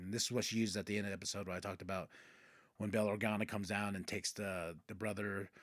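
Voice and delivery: usual speaking voice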